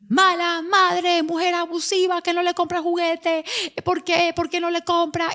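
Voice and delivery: high, very slow scolding voice; mock accusing crowd. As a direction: drag out the accusations like a self-righteous stranger.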